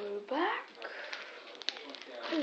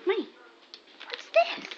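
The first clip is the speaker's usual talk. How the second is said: squeaky, much higher dog-talk; 9 semitones higher, 4 dB louder than usual. Pretend. Teasing the dog, high squeak.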